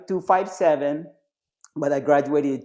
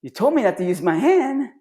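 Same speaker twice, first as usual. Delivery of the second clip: protesting recruit; 8 semitones higher, 3 dB louder than usual. Retold or imitated.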